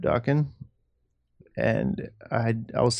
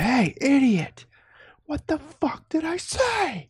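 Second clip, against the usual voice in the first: mock yell at kids; much slower than usual. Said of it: "Then you're just like".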